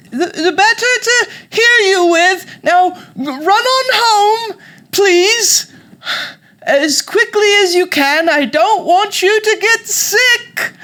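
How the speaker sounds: high, loud, drawn-out British falsetto; fake British grandmother. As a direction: shoo the child away in a wailing granny voice. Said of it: "grandmother said".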